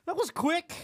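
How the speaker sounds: imitated high-pitched quip; high, hammed-up quote; acted-out hurried remark. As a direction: jump way up in pitch, as if rushing past. Tom rushes up, acted out.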